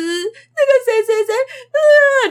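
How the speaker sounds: high, loud whimpering name between sobs; imitating a crying child. Teacher imitating a child crying over the toy car. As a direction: imitate a little kid starting to cry. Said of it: "就开始哭".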